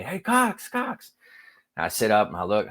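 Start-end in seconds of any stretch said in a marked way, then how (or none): 0.0-1.1 calling out a name, pitched higher; mock yell across a crowd, higher pitch; retold yelling. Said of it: "I hear him yelling at me".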